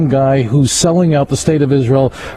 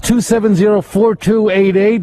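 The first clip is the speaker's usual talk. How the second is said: radio host; 5 semitones higher than usual. Acted. Host opening the show.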